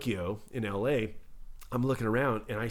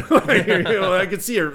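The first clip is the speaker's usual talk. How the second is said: laughing through the words, higher and louder; 7 semitones higher, 9 dB louder than usual. Real, not acted. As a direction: burst out laughing while telling it. Laughing at own story.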